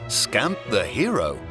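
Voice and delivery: plain reading voice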